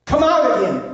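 commanding rebuke. Acted. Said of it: "And then".

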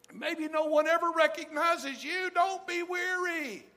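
high, loud, slow preacher cry; impassioned plea. Real, not acted. Preacher urging tired servants, in a high, loud, slow cry. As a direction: lift it up and plead with them. Climax of sermon appeal.

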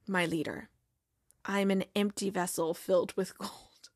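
low, hushed, solemn mock-prophet; grand soft Zava voice. Star player solemnly greeting his new manager, low and hushed. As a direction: intone it like a self-serious guru.

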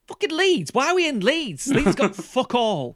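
put-on high, outraged Leeds accent. Friend complains about films set in Leeds, in a Leeds accent. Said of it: "You're doing a Leeds accent as well".

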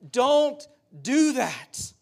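very slow, high, loud begging; yelled plea. Retold or imitated. Preacher voices Paul yelling at loved ones, loud drawn-out plea. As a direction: yell it out word by word, imploring. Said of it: "He is yelling at them".

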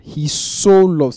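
slow, "so" weighted and lifted; stress on so. Shown as a demonstration. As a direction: press the word "so" in "He so loved". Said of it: "there's an emphasis on so".

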